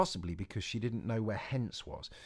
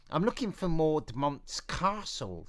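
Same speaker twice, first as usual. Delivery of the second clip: put-on lightly raised girl's voice, brave front; a plucky girl talking herself out of fear; Eliza; 7 semitones higher, 4 dB louder, slower than usual.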